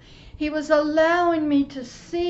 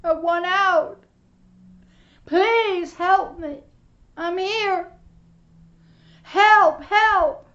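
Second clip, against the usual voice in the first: louder, higher pleading screams from the fire; desperate cries for help; 5 semitones higher, 10 dB louder than usual. Retold or imitated. Preacher voicing souls in the flames crying for help. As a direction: beg for rescue, loud and frantic.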